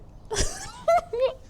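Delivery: high squeal of laughter; can't-say-it giggle, very high; cringing laugh. Real, not acted. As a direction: burst into an embarrassed giggle. Too embarrassed to say the line.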